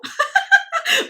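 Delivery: sudden high squeaky laugh; real high giggle. Real, not acted. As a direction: burst into a giggle. Laughing at herself.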